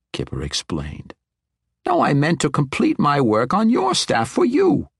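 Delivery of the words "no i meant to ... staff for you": put-on high, insistent old wizard; eager old wizard. Old wizard offers to finish the woman's staff, eager and high. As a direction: insist eagerly with raised pitch.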